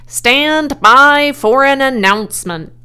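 very loud public announcement; booming loudspeaker. A narrator's reading. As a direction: boom it out over the fair. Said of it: "boomed the voice of the loudspeaker".

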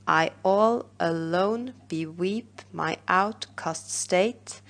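very slow, stressed iambic reading; reading in meter. Shown as a demonstration. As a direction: read the line slowly, marking each beat. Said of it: "Let's have a look".

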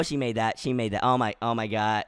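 low, hushed disbelief; stunned dismay. Real, not acted. Player sees the opponent score, hushed low dismay. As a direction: sink into a quiet, deflated murmur.

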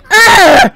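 loud exasperated "ugh"; frustrated shouted grunt. Real, not acted. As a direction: let out an angry groan. Frustrated at game.

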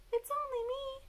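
very high, tiny bat voice; high-pitched storybook bat; bat character. A narrator's reading. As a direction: squeak it right at the top of your voice. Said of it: "said Bat".

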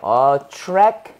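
ATTRACTIVE stretched out slowly in pieces; drawn-out word demo. Shown as a demonstration. Teacher breaking down "attractive" for learners, slow and stretched. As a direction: draw out "attractive" chunk by chunk, end hanging.

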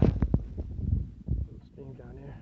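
whispered and very slow; slow whisper over the view; hushed whisper. Real, not acted. Quiet remark while looking down.

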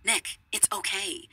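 ordinary delivery

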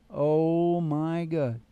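usual speaking voice

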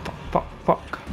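frantic whispered curses; whispered panic. Real, not acted. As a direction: breathe the curses out, terrified. Hiding from monster in horror game.

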